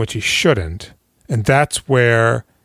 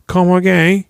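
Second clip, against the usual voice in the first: higher, louder cold query; a lightly voiced crime boss silencing the room; menacing boss; 8 semitones higher, 4 dB louder than usual. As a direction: ask it like a threat. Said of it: "Bricktop just goes".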